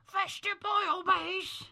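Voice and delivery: high, slow put-on NPC voice; high character voice. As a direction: do Fester in a high silly voice. Voicing NPC Fester.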